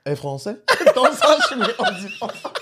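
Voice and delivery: high-pitched comic French accent. Shown as a demonstration. Mocking the friend's attempt, high French accent. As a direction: squeal it in French accent, breaking into laughter. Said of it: "That's French".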